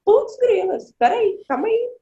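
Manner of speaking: a party guest suddenly told to leave; startled protest. Pretend. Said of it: "Você fala". (protesto surpreso, bem mais agudo e alto)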